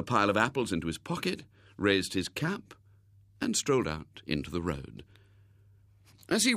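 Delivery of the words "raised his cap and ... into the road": usual speaking voice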